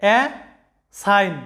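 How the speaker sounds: Uh then sign split with a pause; uh, sign, spelled out slowly; syllable by syllable. Shown as a demonstration. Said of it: "দুইটা syllable আছে".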